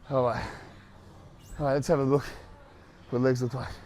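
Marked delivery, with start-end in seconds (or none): none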